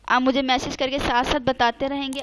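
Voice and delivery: ordinary delivery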